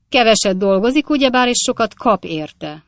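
joking. A narrator's reading. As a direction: tease the witness in front of the court. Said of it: "mondta Basfaz úr tréfálkozva".

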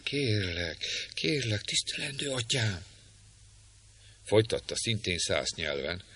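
hushed earnest plea to a holy father; soft pleading maiden. Acted. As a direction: plead gently and humbly, barely above a breath. Rebecca pleads with the priest.

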